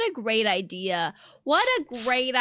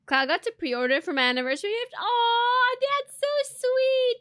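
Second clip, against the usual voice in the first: normal talking voice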